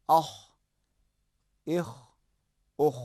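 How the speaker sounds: اخ with a scraping throat sound; rasping kha. Shown as a demonstration. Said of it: "خا کو تلفظ کریں گے تو".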